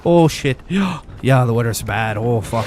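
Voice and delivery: put-on rambling Newfoundland accent with a gasp in the middle. Streamer mimicking a Newfoundlander grumbling about the weather, in a Newfoundland accent. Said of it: "that's Newfoundland".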